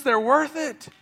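rally the church to serve; preaching at full force. Acted.

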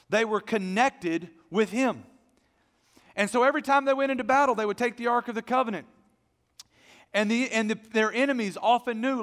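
loud, high, building preaching. Acted. Pastor rallying his church about the Ark, full-force preaching. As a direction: rise to full force for the congregation.

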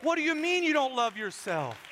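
earnest but lightly voiced God; God pleading. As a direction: voice God protesting over his masterpiece. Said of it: "God is looking at you going".

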